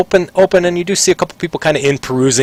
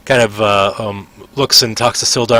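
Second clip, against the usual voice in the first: ordinary talk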